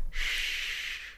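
long soft shushing; hushing shh. A narrator's reading. Captor puts finger to captive's lips, hushing. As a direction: shush them with a drawn hiss.

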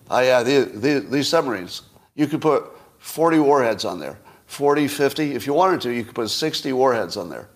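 ordinary talk

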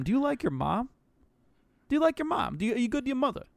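put-on playful Boston accent. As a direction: ask it like a Boston local. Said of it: "like, in a Boston accent".

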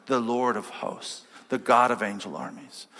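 ordinary talk

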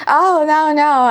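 ordinary talk, nothing marked

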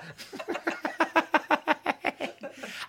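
high, helpless giggling fit; real running laughter; long laugh. Real, not acted. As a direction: keep laughing at your own joke. Laughing at the economy joke.

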